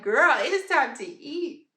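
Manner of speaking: put-on playful pushy girlfriend tone; sassy talking stomach. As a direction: voice a hungry stomach giving orders. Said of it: "my stomach is grumbling. It is like".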